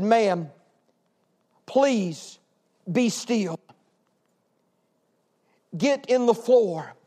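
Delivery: slow, measured calm with long pauses. Retold or imitated. Dispatcher talking a trapped woman through a fire, very calmly. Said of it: "the dispatcher very calmly said".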